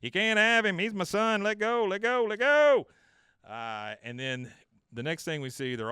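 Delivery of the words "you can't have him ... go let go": desperate shout. Retold or imitated. Dale clutching son, shouting at aliens.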